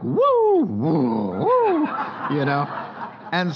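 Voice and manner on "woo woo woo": high, loud whoops up and down; wild whooping. Retold or imitated. Preacher showing his own stormy nature, whooping. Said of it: "I'm like".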